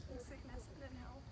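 soft, choked-up vow; emotional vow repeat. Real, not acted. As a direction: hold back tears, softly. Bride repeats vows softly.

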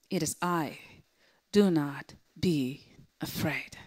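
low, soft and gentle; loving reassurance. Retold or imitated. Jesus reassuring the shocked disciples, lovingly. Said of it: "what a loving sentence that is".

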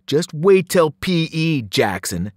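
loud, slow menacing call; drawn-out bully's call; called-out threat. A narrator's reading. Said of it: "Sloan called".

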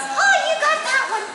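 high, bright-eyed little bunny, pleased with itself; tiny bunny voice. Acted. Little bunny Sword answering Kristoff's riddle, squeaky and proud.

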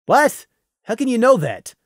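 high, winded disbelief; lightly acted breathless question; still panting. A narrator's reading. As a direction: demand it while a little out of breath. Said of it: "still panting".

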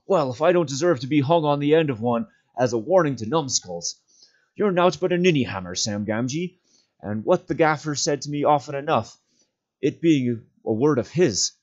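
excited rambling. Acted. Sam scolding himself in excitement and relief, rambling. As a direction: scold yourself in giddy relief. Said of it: "talking wildly to himself in his excitement".

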